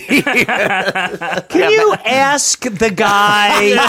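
high, slow laughing plea; giggling mock request to the guy. Real, not acted. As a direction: squeak it out through laughter. Joking request to spirit guy.

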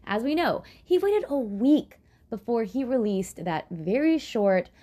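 ordinary talk, nothing marked

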